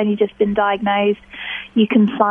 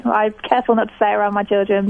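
ordinary talk, nothing marked

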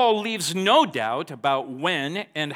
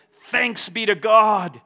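loud, slow, ringing thanks; joyful worship; 6 semitones higher, 7 dB louder, slower than usual. Acted. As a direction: burst into thanks to God. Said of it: "Paul breaks into a spontaneous moment of worship".

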